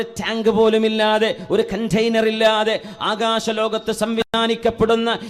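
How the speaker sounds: ringing sermon delivery with sustained phrase endings; projected preaching. Real, not acted. Preacher urging believers to thank Allah, projected sermon voice. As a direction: preach it out to a whole congregation.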